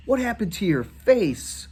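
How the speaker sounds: slow, high, sneering taunt; mocking bully. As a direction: drag out the cruel question. Bully mocking a disfigured face.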